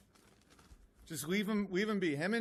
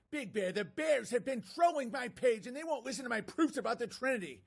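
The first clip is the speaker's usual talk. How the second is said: mock whiny fan, pitched higher; 7 semitones higher than usual. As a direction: read it like a needy pestering follower. Mocking a fan's message.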